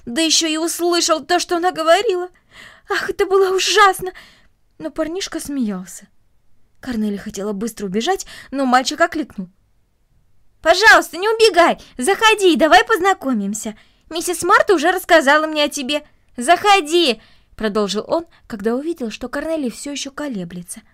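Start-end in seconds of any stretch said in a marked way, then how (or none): 0.1-4.1 higher, louder rush of mortified dismay. Acted. Girl realizing the newcomer overheard her, dismayed. Girl's dismayed inner exclamation. 10.6-17.2 high, loud friendly plea; eager calling out. Acted. Boy urging a fleeing girl to come in, calling out eagerly.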